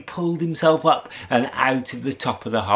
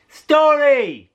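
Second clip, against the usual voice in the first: "Story!" shouted higher and louder, falling at the end; louder call of Story; 7 dB louder than usual. Shown as a demonstration. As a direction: really belt out "Story!" this time. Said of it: "Let's try again".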